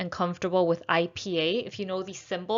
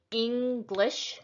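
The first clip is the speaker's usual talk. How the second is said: English split slowly into two beats, Eng-glish; syllable by syllable; 3 semitones higher than usual. Shown as a demonstration. Teacher showing two beats in English, syllable by syllable. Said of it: "There are two syllables".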